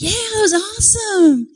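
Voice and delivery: imitated high excited agreement; enthusiastic crowd. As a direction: answer like a thrilled audience. Imitating crowd answering the acrobat.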